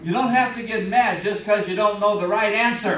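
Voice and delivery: loud mock-indignant protest; indignant lion. Battered lion complaining to the elephant, indignant. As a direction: protest loudly as the wounded braggart.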